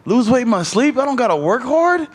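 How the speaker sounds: high, excited disbelief; like hearing a miracle diet; amazed disbelief. Retold or imitated. To himself watching a weight-loss video, amazed and high-pitched. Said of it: "I'm like".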